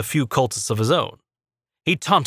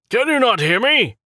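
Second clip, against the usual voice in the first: high, loud, impatient shout; 12 semitones higher, 4 dB louder than usual. A narrator's reading. Acolyte shouting at Rowan, high impatient shout. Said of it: "shouted the acolyte by Rowan".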